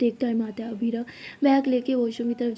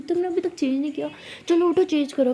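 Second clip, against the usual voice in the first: higher, bossy elder-sister voice; nagging scolding; 4 semitones higher than usual. Acted. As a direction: nag her into getting up. Older sister nags her to change.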